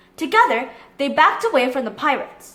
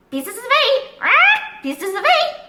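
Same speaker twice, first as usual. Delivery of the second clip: parrot squawk; 10 semitones higher than usual. Acted. The parrot calling from above, high parrot squawk. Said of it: "called a voice overhead".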